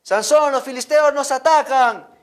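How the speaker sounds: high, loud alarm call; shouted warning. Acted. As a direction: yell his name in alarm. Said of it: "le grita".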